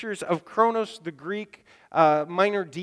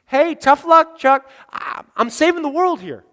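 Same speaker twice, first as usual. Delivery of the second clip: loud, higher, breezy dismissal; mock brush-off; 7 semitones higher, 9 dB louder than usual. Preacher playing a Jesus who dismisses the blind man, loud mock brush-off. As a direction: shrug them off with a smirk.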